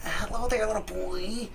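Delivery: high, sing-out mock greeting; creepy neighbor imitation. Creepy neighbor calling to a little boy, high mock holler. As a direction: call it high in a put-on creepy voice. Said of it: "he would always be super loud".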